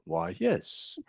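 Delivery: a hint of a prim British butler; polite little butler reply. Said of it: "British butler voice".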